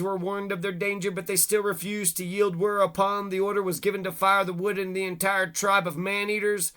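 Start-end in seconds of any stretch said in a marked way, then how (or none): none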